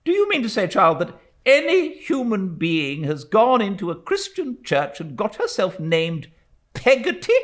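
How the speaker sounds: be scandalised by the name; indignant old aunt. A narrator's reading. Stern aunt scolding the widow over a name, indignant.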